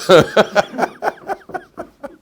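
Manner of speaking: high-pitched burst of laughter; high cackle. Real, not acted. Teacher laughing at his own joke, high cackle.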